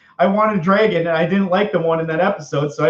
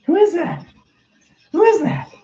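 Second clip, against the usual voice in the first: much higher, alarmed; startled question; 12 semitones higher than usual. Acted. Seeing a stranger in the video, high startled question. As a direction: ask it pitched up in shock.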